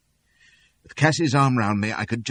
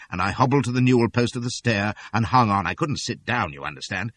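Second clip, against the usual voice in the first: usual speaking voice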